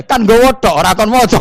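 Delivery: very loud, high mock yell; hammed-up shouting retort. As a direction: yell it back with comic outrage. [name] shouts back, audience laughing.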